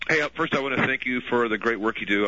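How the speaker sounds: normal talking voice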